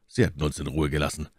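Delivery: plain reading voice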